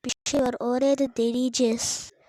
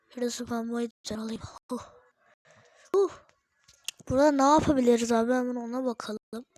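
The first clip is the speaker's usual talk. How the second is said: ordinary delivery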